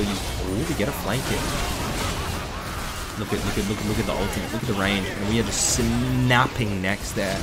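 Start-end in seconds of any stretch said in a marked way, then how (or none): none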